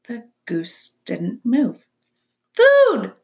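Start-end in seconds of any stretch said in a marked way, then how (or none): 2.5-3.1 put-on tiny high-pitched baby bird cry; peeping gosling. A narrator's reading. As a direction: peep loudly like a hungry hatchling. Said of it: "peeped the gosling".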